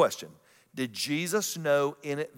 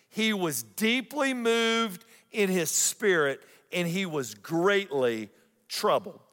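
slow, high, swelling preacher read; dramatic scripture reading; 5 semitones higher, slower than usual. Acted. Pastor reading the verse to the congregation, slow and solemn. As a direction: lean into every word of the verse.

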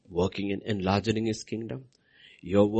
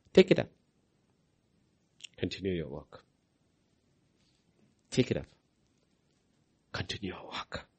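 fading to a whisper; 3 semitones lower, 5 dB quieter than usual. Acted. Prophet's words, fading to whisper.

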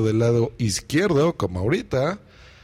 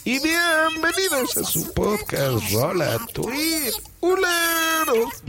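much higher, slow, stretched cartoon voice; pitched-up slow podcast character greeting the listeners; Rolo voice; 11 semitones higher, slower than usual. Shown as a demonstration. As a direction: drag it out slowly in a squeaky pitched-up character voice. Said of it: "y hablo así".

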